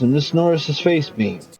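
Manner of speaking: ordinary delivery, nothing marked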